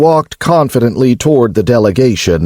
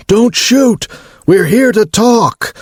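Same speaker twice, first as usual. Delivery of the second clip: shouting; 11 semitones higher than usual. A narrator's reading. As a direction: yell it out to the guards. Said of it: "the cyborg with the peeling skin shouted".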